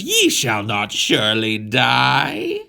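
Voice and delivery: slow, solemn mock-biblical line; slow scripture serpent. Serpent reassuring Adam in mock-biblical style, slow. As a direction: draw out the old-English words.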